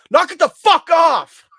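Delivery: loud, high, slow yell of someone finally losing it; furious yell. Retold or imitated. Rick finally loses it at the fake water, furious yell. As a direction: blow up and bellow it out. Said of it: "He just finally loses it".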